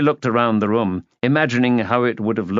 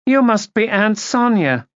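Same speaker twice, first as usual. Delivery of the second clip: put-on high-pitched society greeting; high, clear voice; 12 semitones higher, 4 dB louder than usual. A narrator's reading. Niece greeting her aunt from the stairs, high clear voice. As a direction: raise it high and enunciate clearly. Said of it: "said in a high, clear voice".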